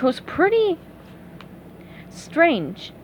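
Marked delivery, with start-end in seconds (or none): none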